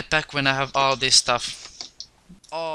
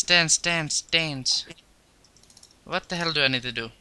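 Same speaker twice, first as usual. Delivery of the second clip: ordinary talk, nothing marked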